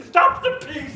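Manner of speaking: high, loud shout. Retold or imitated. Man shouting to Limerick people to stop pigs, high and loud. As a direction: yell it after the pigs. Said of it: "and he shouted out".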